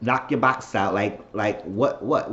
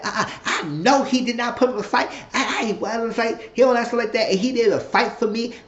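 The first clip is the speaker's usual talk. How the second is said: much higher voice quoting her on the phone; high put-on woman's voice; 12 semitones higher than usual. Retelling her call to a friend, high mock voice. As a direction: lift the voice high as her complaining. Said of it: "She said".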